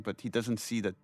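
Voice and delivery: usual speaking voice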